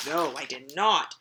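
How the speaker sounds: deadpan no from the unwilling cat; contrary cat. Acted. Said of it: "And the cat says".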